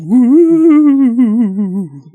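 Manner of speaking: high, loud ghostly woo; spooky woo. Acted. Host kicking off the Halloween episode, spooky hoot. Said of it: "Me going, "Woo!".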